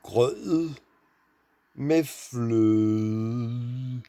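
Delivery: grød with a throaty r, then med fløde stretched out slowly; teacher drawing out the Danish vowels. Shown as a demonstration. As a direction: break grød med fløde into slow careful sounds. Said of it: "Now it's".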